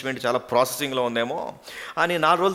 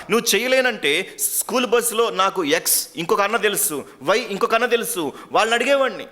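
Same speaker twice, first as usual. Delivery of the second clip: tearful reproach; 5 semitones higher, 3 dB louder than usual. Acted. Beaten boy accusing the sister who broke her promise, reproachful. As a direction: blame her for betraying you.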